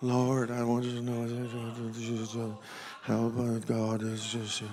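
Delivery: slow, soft, low mumbled praying; mock timid prayer, trailing along; low-keyed prayer. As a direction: pray so low it barely carries. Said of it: "it's low-keyed".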